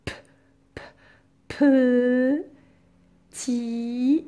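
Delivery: "P, p, petit" with bare consonants, then held syllables; sounding out. Shown as a demonstration. Teacher correcting petit for pupils, sounding it out.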